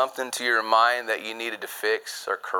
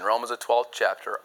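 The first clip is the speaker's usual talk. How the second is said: normal talking voice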